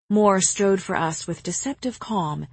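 ordinary delivery

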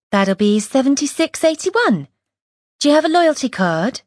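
put-on young till girl, pitched well up; shop assistant voice. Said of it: "The girl at the till".

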